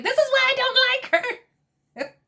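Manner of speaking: very high mock-crying squeal; hammed-up high-pitched whining; mock flustered whine. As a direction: imitate someone losing an argument in a squeaky wail. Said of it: "Teresa's fumbling her words".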